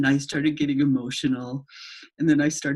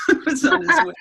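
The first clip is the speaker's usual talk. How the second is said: laughing; 13 semitones higher, 6 dB louder than usual. Real, not acted. Laughing at the funny story.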